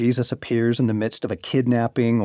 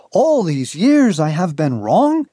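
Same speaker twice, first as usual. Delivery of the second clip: dismayed; 6 semitones higher, 5 dB louder than usual. Retold or imitated. Listeners' dismayed quote.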